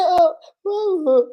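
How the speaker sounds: high, loud put-on wailing; mock crying. Girl starting to cry when accused of lying, high mock wail. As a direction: imitate someone bursting into fake tears. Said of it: "they start crying".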